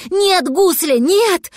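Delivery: high, urgent plea; desperate cry. A narrator's reading. Cat begging the dragon away from the double, high desperate cry. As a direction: cry out to stop him, pitch well up.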